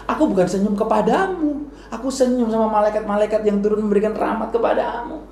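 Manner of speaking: lightly voiced wise teacher; a hint of the Prophet's voice. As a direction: explain gently but with rising fervour. Prophet explains, voiced by storyteller.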